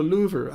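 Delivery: "louver" with an oo in place of short i; mispronounced liver. Acted. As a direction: say "louver", LOO-ver, for liver. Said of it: "In my country".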